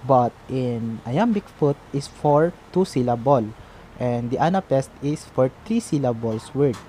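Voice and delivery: ordinary delivery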